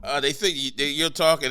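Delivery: ordinary delivery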